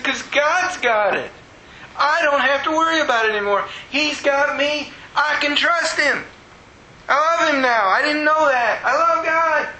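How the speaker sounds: high, fervent outpouring; retold happy bawling. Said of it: "I'm still bawling".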